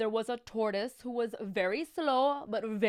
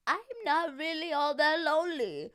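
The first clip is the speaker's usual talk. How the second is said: put-on old lady voice; 6 semitones higher than usual. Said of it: "said the little old lady".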